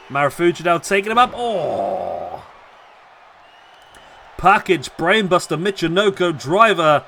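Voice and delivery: lively ringside wrestling call; wrestling commentator calling the match live; live play-by-play. Acted. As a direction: call every move as it lands.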